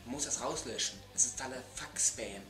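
usual speaking voice

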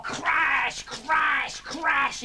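high, drawn-out crashes; dramatic repeated high exclamations; crashing sound effect. A narrator's reading. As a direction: stretch each crash like plates smashing. Narrator imitates dishes smashing.

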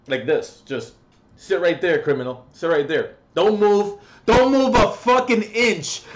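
a hint of a shrill bossy neighbour; mock bossy neighbour. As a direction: imitate her bossing him. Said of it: "she just wants me to sit right here".

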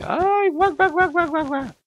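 high falsetto squawk and clucks; comic high-pitched hen imitation; chicken clucking. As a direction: cry out, then cackle like a hen. Imitates the escaping hens.

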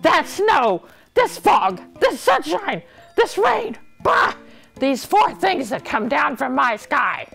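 high, ranting royal tantrum; angry shouting. A narrator's reading. King rages at the weather, shouting. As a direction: shout each thing out in outrage. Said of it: "he started shouting".

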